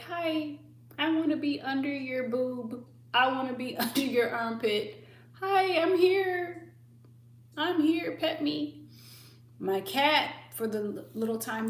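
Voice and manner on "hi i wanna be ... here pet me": clingy dog begging for attention; needy dog voice. Retold or imitated. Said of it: "He was just like".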